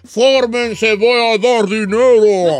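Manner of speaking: slow, drawn-out mock announcement; comically slowed-down proclamation; what others hear. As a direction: stretch every word like a distorted echo. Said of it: "y ellos escuchan".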